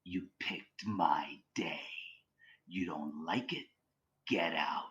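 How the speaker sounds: low, slow, whispery menace; near-Gollum whisper, drawn out; bad spirit's voice. Imitating the malevolent spirit on a recording, low menacing whisper. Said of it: "you hear the other voice go".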